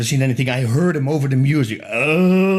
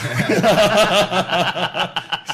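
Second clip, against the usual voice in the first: big real laugh; 6 semitones higher than usual. Real, not acted. Laughs at his own punchline.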